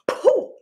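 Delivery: playful pooh. Acted. Playful exclamation after pronunciation.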